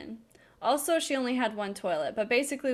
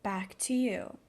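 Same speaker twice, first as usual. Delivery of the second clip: news anchor voice; 3 semitones lower, 4 dB quieter, slower than usual. Shown as a demonstration. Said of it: "say it like a news anchor".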